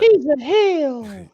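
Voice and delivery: high, slow, drawn-out announcer call; mock announcer. Announcing the wrestler to his friend, mock announcer voice. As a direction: ham up the heel call.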